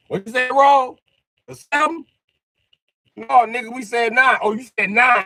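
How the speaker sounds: high loud voices arguing over the roll; loud high banter. Retold or imitated. Kids arguing over the dice call, loud high shout. Acted dice game back-and-forth.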